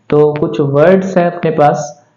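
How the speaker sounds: ordinary delivery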